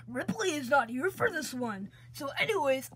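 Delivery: normal talking voice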